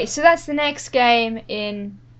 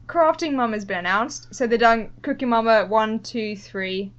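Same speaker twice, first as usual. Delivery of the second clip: ordinary talk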